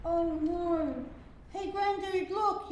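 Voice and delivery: loud, high alarmed yell; a child spotting danger. A narrator's reading. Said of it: "yelled Lucy".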